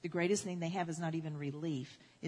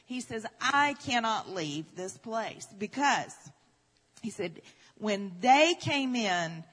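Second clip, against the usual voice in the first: higher and louder, pressing the story to its peak; impassioned revival preaching; preaching at full force; 5 semitones higher, 5 dB louder than usual. Acted. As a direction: preach it with mounting fervour. Preaching climax, rising intensity.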